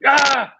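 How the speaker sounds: loud, high effort shout; hammed-up strain yell; mock yell. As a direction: let out a pushing roar. Acting out the quitting yell.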